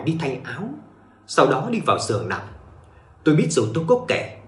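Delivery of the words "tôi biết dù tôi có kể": ordinary delivery, nothing marked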